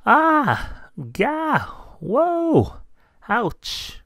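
squeaky cries tumbling down; high yelps of falling. Acted. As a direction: yelp as each bump hits. Mouse tumbles into paint.

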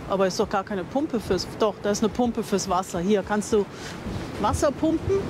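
ordinary talk, nothing marked

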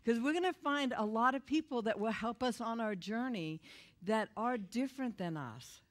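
usual speaking voice

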